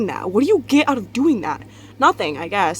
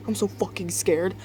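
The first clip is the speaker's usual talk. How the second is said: ordinary talk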